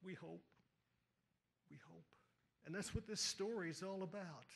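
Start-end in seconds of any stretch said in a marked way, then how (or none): 0.0-0.4 softer echo. Shown as a demonstration. Repeated phrase fading away. 1.7-2.1 almost breathed, much softer, fading out; near-silent echo. Shown as a demonstration. Preacher fading the congregation's hope to a breath. Repeated phrase fading to nothing.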